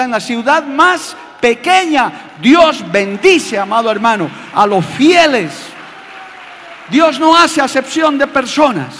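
loud, slow preaching at full force, building; predicación a pleno pulmón. Acted. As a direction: proclaim God's blessing, loud and drawn out. Preaching climax on blessing. (proclamando a gritos, lento y con fuerza)

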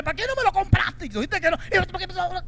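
a hint of an annoyed wife; complaining wife. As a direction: play the wife who scolds him. Wife scolding husband, acted quote.

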